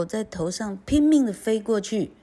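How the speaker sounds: usual speaking voice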